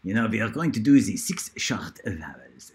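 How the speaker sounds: playful put-on French accent, lilting up and down; mock-French teacher voice. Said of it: "that is a bit of a French accent".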